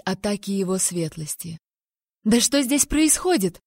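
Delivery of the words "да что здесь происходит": higher-pitched, indignant outburst; furious cry. A narrator's reading. Heroine struggling with the crazed duke, furious cry. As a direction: exclaim in understated fury. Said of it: "в ярости выкрикнула я".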